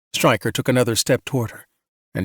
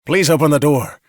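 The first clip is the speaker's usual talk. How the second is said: raised, urgent begging; shouted plea; 4 semitones higher, 5 dB louder than usual. A narrator's reading. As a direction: plead loudly to be let inside. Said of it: "he shouted".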